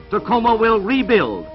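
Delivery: ordinary talk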